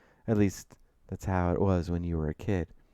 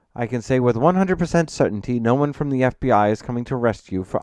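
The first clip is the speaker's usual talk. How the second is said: louder, assured professional voice; authoritative, confident; 4 semitones higher, 7 dB louder than usual. A narrator's reading. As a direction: lay down the facts with confidence. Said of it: "presenting a tone of authority and confidence".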